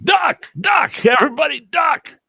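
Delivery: very high-pitched urgent shouts; yelling a warning. Retold or imitated. Man warns packed bar of a gunman, yelling. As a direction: yell to get a whole room on the floor. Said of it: "I'm yelling".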